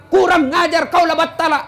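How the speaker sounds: high-pitched mock outburst; angry husband, acted. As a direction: play a quarrelling husband telling off his wife. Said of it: "Kalau dia marah". (menirukan suami marah, nada tinggi)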